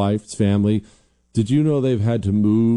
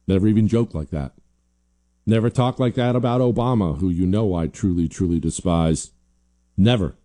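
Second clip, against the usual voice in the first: normal talking voice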